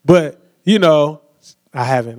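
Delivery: ordinary talk, nothing marked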